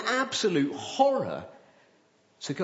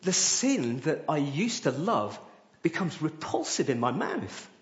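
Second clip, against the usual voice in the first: normal talking voice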